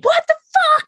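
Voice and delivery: high, startled yelp of a question; mock shocked squeal; shocked outburst. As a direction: shriek it in disbelief. Woken by a stranger, shocked.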